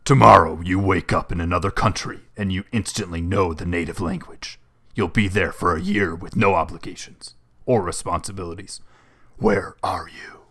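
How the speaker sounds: grisly movie trailer announcer voice; hammed-up, grisly announcer read, slowing into the final question. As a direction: read it like a dramatic trailer voiceover, punching the openings. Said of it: "a movie announcer voice".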